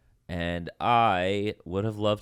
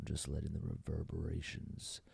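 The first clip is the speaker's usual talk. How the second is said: close to the microphone; 3 semitones lower, 11 dB quieter than usual. Shown as a demonstration. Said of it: "really close to the microphone".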